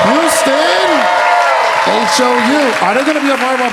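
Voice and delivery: high shout calling out the city; hype shout. Real, not acted. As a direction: call out the city name to the crowd. Hyping the live crowd.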